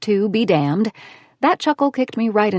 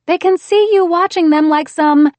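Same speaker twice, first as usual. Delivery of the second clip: bright high scolding woman; heroine voice; 10 semitones higher, 8 dB louder than usual. A narrator's reading. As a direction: scold him, pitched up and loud.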